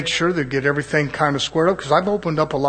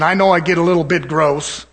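normal talking voice

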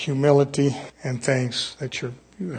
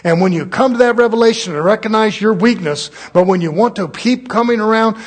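loud, high fired-up sermon; preaching at full force; 6 semitones higher, 7 dB louder than usual. Acted. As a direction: preach it hard to the congregation.

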